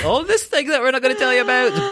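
high, loud, coy teasing voice; mocking podcast host. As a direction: tease like a podcaster hiding a secret. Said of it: "Which I love on podcasts is when people are like".

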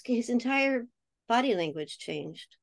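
usual speaking voice

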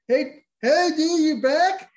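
high, loud bro greeting from across the yard; imitating the neighbor. Neighbor hollering a greeting at the returning dad, high and loud. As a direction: call out to him like an excited buddy. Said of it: "He's like".

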